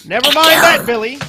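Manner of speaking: put-on loud, high, cross mother snapping at her child; loud, high scolding mother mouse; sharp scolding. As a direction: cut him off like an angry mother.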